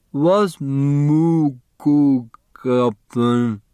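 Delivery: put-on very slow, effortful boy's voice, high; halting boy. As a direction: drag each word out with effort. Chris struggles to read menu.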